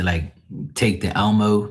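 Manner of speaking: usual speaking voice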